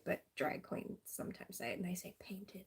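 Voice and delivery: soft, whispered confiding; whispered aside. Real, not acted. To viewers, sharing a drag queen saying in a whisper. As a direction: lean in and whisper it.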